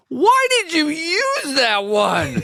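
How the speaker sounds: put-on high, slow, laughing kid's whine. Son asking dad about the photo, high and laughing. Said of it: "he was like".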